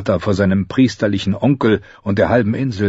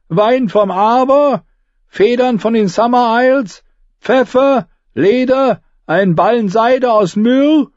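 captain calling out; 4 dB louder than usual. A narrator's reading. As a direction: holler the wares across to the quay. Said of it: "Sie schrien dem Kapitän ihre Fragen zu".

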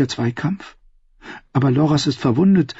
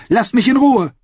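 angry dismissal; 12 semitones higher, 4 dB louder than usual. Acted. Margeri snaps at Cersei.

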